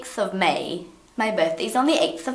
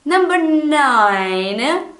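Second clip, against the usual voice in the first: announcer call; 7 semitones higher, 5 dB louder, much slower than usual. Real, not acted. Announcing list item.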